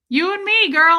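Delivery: high, loud mock-buddy exclamation; playful chumminess. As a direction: play it up as her best pal. Playfully chummy with her accountant.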